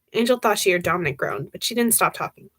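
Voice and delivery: ordinary delivery, nothing marked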